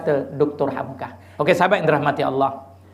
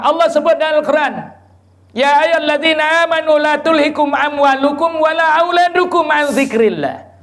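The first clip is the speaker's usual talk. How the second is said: high, raised sermon delivery, verse sung out; full-force preaching; 8 semitones higher, 3 dB louder than usual. Acted. Preacher expounding a verse to the crowd, full-force preaching. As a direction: drive the warning home.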